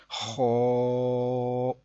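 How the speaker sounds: خو thick and rounded, held long; full, heavy sound. Shown as a demonstration. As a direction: hold خو evenly with a full mouth. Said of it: "اس کو ہم پر پڑھیں گے". (خو موٹی آواز میں لمبا)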